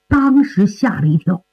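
usual speaking voice

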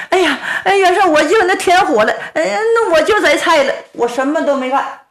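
high, fast, frightened babble; nervous pleading. Acted. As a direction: rush the excuses out in panic. Cooks protest innocence.

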